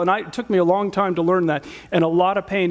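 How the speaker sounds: normal talking voice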